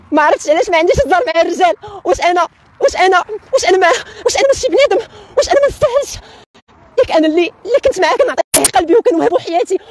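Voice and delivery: high dubbed-drama woman's voice; man doing a woman's voice. Pretend. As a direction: plead high like a soap heroine. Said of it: "غتعطيني بعدا واحد التقليد ديال شي صوت نسائي ديال شي فيلم مدبلج".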